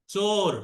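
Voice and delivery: চোর with a round o like chor; চোর, vowel an open rounded o; o sound. Shown as a demonstration. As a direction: say চোর as chor, not choor. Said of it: "ও এর মতো হচ্ছে চোর".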